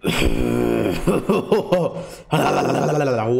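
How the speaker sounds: a long, real, giggling laugh; long burst of laughter. Real, not acted. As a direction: break up into a long giggle fit. Laughing at the spicy snack.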